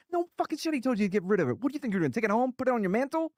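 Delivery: high, exasperated mock tirade; angry sergeant. Platoon sergeant chewing out the lieutenant, high and exasperated. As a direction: scold him like he's an idiot. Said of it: "He's angry".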